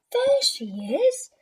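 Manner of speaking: put-on child spotting the cat; excited shout. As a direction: cry out with relief. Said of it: "shouted Nicky".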